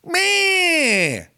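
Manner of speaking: whiny high meh; high, drawn-out cat cry. Retold or imitated. Host mimics the cat's yowl, high and long. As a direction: wail it like a yowling cat. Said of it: "And then he just goes".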